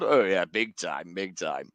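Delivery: very low, soft mock-suave reply; imitated captain, hushed and deep; Picard voice. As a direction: drop right down and murmur it as the captain.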